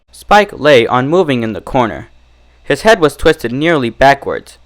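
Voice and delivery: plain reading voice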